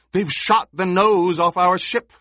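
high, loud panicked call. Acted. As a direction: shout it across the room. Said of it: "someone called".

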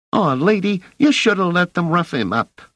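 put-on high boy's voice, cheeky and disappointed; a scrappy street urchin egging her on; street kid. Narrator voices street boy Rache.

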